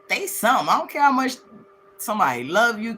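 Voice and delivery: ordinary talk, nothing marked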